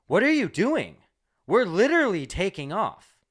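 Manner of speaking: high, loud, startled scold; flight attendant scolding. Retold or imitated. Flight attendant catching a passenger up during takeoff, loud and shocked. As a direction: bark it up at him in disbelief.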